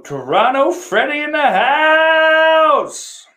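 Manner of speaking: huge, high, drawn-out shout; high, loud arena-style call; hype announcement. Pretend. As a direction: holler the arrival like a hype man, stretching the end. Announcing an arrival to the chat.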